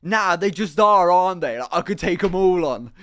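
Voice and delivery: put-on loud, high drunken Irish rant; drunk Irish guy. Drunk Irish guy ranting about the English, loud Irish accent. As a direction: bellow it like a drunk Irishman in a pub. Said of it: "And he's like".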